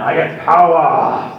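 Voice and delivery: slow, a hint of a cartoon hero boast; lightly voiced muscle-man brag; slow mock heroic boast. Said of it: "That was He-Man".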